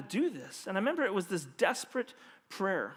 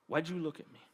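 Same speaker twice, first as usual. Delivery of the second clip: quieter, letting the question hang; soft, weighty repeat; 4 semitones lower, 8 dB quieter than usual. Acted. Pastor repeats Carlos's question to the congregation, hushed and weighty. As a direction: ask it softly to the room, inviting reflection.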